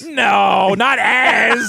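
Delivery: loud, high no; shouted protest. Real, not acted. Cohost shouting down the idea, loud protest.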